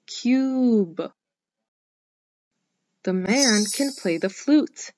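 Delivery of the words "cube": Cube drawn out as "kyoob"; long U sound. Shown as a demonstration. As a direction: stretch the "yoo" in Cube. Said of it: "This word has the U sound".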